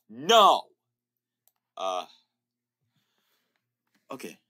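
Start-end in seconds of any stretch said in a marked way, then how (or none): 0.1-0.6 loud emphatic no; shouted no. Real, not acted. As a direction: bark a loud refusal. Rejecting sprite.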